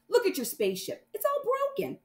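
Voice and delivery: high tattling brother; taunting little sibling; teasing kid voice. Acted. Brother mocks.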